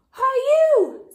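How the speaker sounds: high, loud grouchy call; grouchy hail. A narrator's reading. Grouchy ladybug accosting the yellow jacket, high and loud. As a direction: call out to pick a fight.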